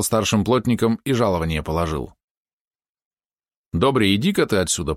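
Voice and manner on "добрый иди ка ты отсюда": ordinary delivery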